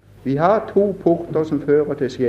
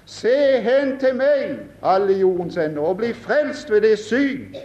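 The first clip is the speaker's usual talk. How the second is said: fervent preaching; 7 semitones higher than usual. Acted. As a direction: draw it out as a summons. Preaching at full force.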